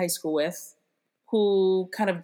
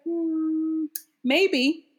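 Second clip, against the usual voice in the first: high, drawn-out doubtful hum; skeptical imitation; 11 semitones higher, 3 dB louder than usual. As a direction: play a cousin who isn't convinced. Cousin doubts her in retold chat.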